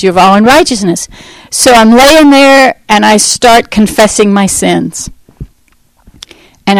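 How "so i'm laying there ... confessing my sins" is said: ordinary talk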